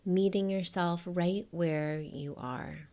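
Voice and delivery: soft, unhurried meditation voice with long pauses; hushed, spacious guidance, letting each phrase rest; guided meditation. Acted. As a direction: gently guide the listener to settle in.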